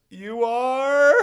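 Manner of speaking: high, loud wailed question; hammed-up devastated shock; mock crushed disbelief. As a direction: imitate a drunk near tears. Drunk woman hears shock news.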